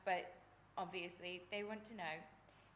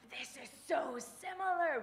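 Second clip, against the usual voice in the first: high, loud, slow wonder; delighted recognition; 8 semitones higher, 9 dB louder than usual. Retold or imitated. Host telling the guest her reaction to his stories, high slow delight. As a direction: stretch it out, thrilled.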